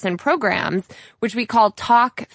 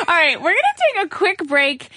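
words pushed out through a laugh, pitched up; laughing while talking; 8 semitones higher, faster than usual. Real, not acted. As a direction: still cracking up as you announce the break. Still laughing into the break.

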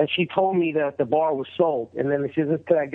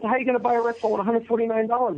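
incredulous friend; 8 semitones higher, faster than usual. Retold or imitated. Friend doubts, repeating question.

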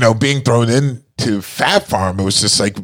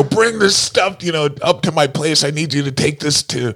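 boss giving orders; 7 semitones higher than usual. Retold or imitated. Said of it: "He'd be like".

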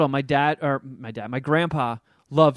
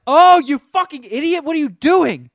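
exasperated yell at the screen; 11 semitones higher, 9 dB louder than usual. Retold or imitated. Yelling at the dad on screen.

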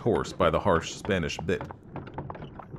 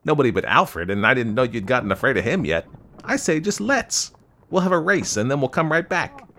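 put-on louder, higher, animated young man; boyishly eager young man; 6 semitones higher, 5 dB louder than usual. A narrator's reading. Young man proposes a race, boyishly eager. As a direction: dare her boyishly, voice climbing.

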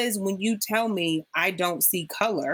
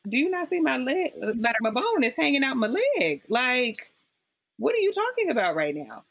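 exasperated; 6 semitones higher than usual. Retold or imitated. Exasperated retort to paper-cut friend.

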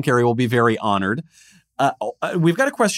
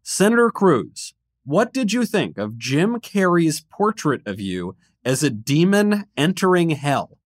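slow, higher-pitched theatrical read; mock-grave question reading; 5 semitones higher, slower than usual. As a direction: draw out each phrase for comic suspense. Host reads a mailbag question theatrically.

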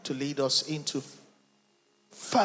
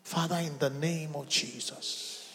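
normal talking voice

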